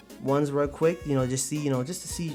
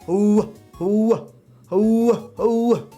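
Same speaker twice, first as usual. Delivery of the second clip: high, loud drawn-out hoots; loud hooting; 7 semitones higher, 5 dB louder, much slower than usual. Pretend. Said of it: "Can I get a hoo".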